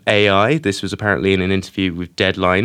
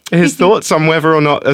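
usual speaking voice

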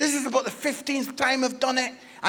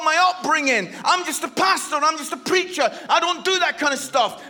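raised-pitch, driving sermon delivery; impassioned preaching; 5 semitones higher, 4 dB louder than usual. Real, not acted. Preacher urging the audience not to limit God, fervent exhortation. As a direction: press the message home fervently.

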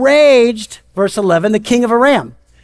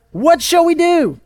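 mock panic, higher drawn-out cry; freaking out; 8 semitones higher than usual. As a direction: act out a panicked servant, slower and higher. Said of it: "the servant freaks out".

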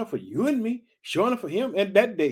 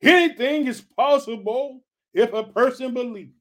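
Jesus proclaiming; 5 semitones higher, 5 dB louder than usual. Retold or imitated. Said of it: "Jesus asks".

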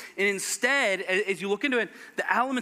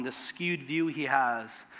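ordinary talk

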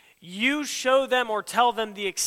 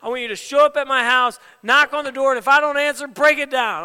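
mock demand; 4 semitones higher, 7 dB louder than usual. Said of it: "someone tell me before".

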